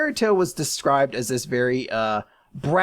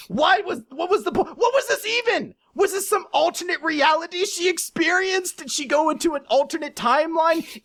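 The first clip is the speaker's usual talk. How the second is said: exasperated rant; 13 semitones higher than usual. Acted. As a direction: hammer each question in high, incredulous exasperation. Exasperated rant at the pointless chapter.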